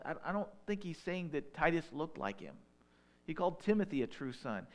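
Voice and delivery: ordinary talk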